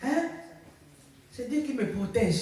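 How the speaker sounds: high, loud preaching at full force; fervent preaching. Real, not acted. Preacher testifying to the church about God's healing, fervent and loud. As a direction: drive each line home to the congregation.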